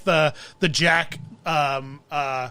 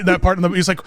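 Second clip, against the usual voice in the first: ordinary talk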